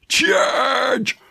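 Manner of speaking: rally the troops into the attack; battle cry. Pretend. Host rousing his viewers with a mock battle cry.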